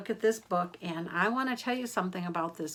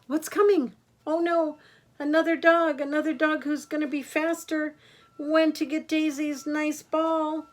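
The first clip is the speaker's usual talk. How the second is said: higher, louder, breathless alarm; excited storytelling; 6 semitones higher, 6 dB louder than usual. Acted. Grandma excitedly narrating the other dog coming. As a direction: build the danger for the kids.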